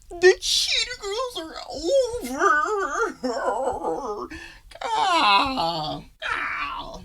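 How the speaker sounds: high, wailing hammed-up sob while talking; mock crying. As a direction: overact the ugly cry to mock her. Mocking Raven's fake crying scene.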